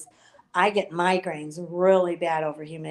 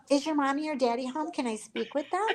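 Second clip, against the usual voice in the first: lightly voiced high caller talking to a child; high, polite caller impression; imitating phone callers; 6 semitones higher, 4 dB quieter than usual. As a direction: mimic a caller asking a child, pitched up. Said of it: "people would call my house, they were like".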